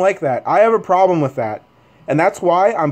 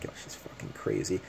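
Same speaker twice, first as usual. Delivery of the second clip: hushed aside to the couple; telling the couple quietly she's crazy; whisper; 11 semitones lower, 18 dB quieter than usual. Retold or imitated. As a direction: whisper it so she can't hear. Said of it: "I'm like".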